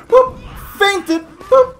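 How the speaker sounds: high, punchy boop voice; playful squeak. Pretend. Player showing his planned trick, high silly squeak. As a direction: squeak out the fake move.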